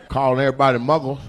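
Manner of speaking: normal talking voice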